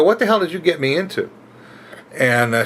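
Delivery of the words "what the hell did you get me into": high, stunned what the hell; alarmed demand. Retold or imitated. Driver confronting the passengers, alarmed. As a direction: demand answers in shock. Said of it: "I go".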